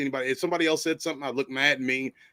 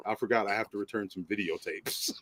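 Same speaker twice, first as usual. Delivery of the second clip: sociopath deadpan; 6 semitones lower than usual. Acted. Quoting American Psycho before walking out, sociopath deadpan fading low. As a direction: excuse yourself blankly, voice dropping away. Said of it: "with that sociopath stare".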